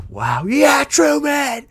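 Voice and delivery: high, loud cheering yell, rising to a shout on the last "Yeah!". Retold or imitated. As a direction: cheer him on like a fan at the screen. Cheering Truman at the ending.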